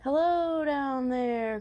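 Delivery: high, slow, loud calling hoot; drawn-out owl greeting from up high; Owl calling from above. Acted. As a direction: call down from a tall tree. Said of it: "called a voice from above".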